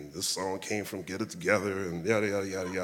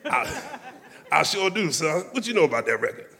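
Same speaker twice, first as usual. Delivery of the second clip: put-on raised, louder, amused old-soul rasp of a legend; 8 semitones higher, 4 dB louder than usual. Quoting [name] at the Apollo.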